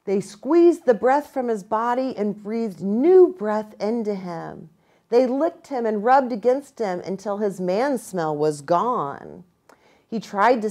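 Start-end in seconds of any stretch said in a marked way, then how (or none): none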